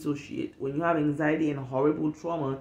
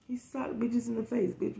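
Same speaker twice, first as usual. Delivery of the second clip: sneaky whispered jab to the audience; whispered aside; 4 semitones higher, 6 dB quieter than usual. Real, not acted. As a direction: hiss the insult under your breath.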